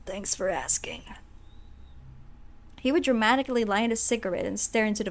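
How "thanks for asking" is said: soft, toneless undead whisper; zombie whisper. Acted. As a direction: breathe it out like a half-dead client. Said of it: "that's how he's gonna talk".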